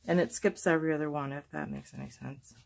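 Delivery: ordinary talk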